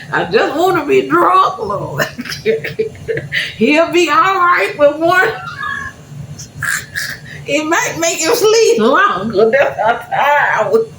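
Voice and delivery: loud, high-pitched comic wail; crying. Retold or imitated. Exhausted mother pleading to get drunk, hammed-up crying. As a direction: bawl and plead in an exaggerated squeal. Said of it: "I remember crying because I couldn't drink".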